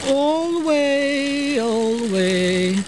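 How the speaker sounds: all the way stretched very slow; drawn out. Shown as a demonstration. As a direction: pull the words out long like the seam. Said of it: "drawing out the stitch".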